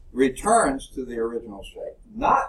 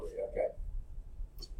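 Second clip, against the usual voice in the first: whispered aside; 5 semitones lower, 14 dB quieter, much slower than usual. Real, not acted. Teacher's aside after a joke.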